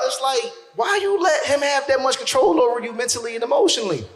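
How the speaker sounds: ordinary talk, nothing marked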